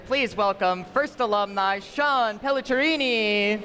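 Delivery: announcer introduction. Acted.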